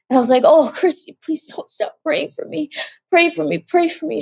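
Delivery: real tearful, high-pitched pleading. Real, not acted. Sister begging a child to keep praying, tearful. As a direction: voice lifted high, close to crying. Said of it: "It's gonna make me cry".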